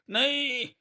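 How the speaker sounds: very high, drawn-out acted cry; terrified scream. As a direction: scream as the killer ants swarm out. Bandits see the killer ants. (दहशत भरी ऊँची चीख)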